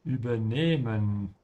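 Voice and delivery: Übernehmen with the weight on nehm, long e; slow teacher model of Übernehmen, stress on nehm; stress on the second part. Shown as a demonstration. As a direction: stretch the e in Übernehmen. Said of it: "The emphasis is on the second part".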